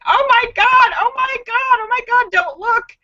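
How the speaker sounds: very high mock panic; frantic imitation of scandalized onlookers; women's horrified outcry. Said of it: "women that are filming it going".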